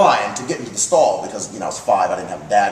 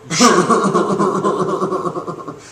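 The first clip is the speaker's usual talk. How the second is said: higher, rattling imitated chuckle; Betty; 4 semitones higher than usual. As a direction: do the godmother's long stuttering laugh. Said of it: "She laughed like this".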